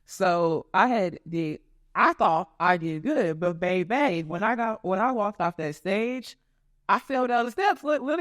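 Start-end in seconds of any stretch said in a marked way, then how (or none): none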